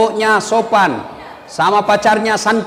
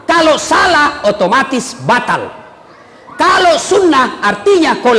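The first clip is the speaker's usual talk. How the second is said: high, slow emphatic sermon; preacher hammering home the point; preaching; 6 semitones higher than usual. Acted. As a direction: preach it with force. Preaching at full force.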